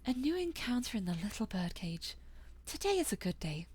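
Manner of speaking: soft-spoken, measured host; genteel cafe owner. Acted. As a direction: invite guests softly and courteously. Cafe owner welcomes guests.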